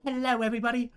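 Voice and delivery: high, loud British TV host voice; hammed-up British ghost-show host; British presenter. Character presenting a ghost show in British voice. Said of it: "why did I make her British".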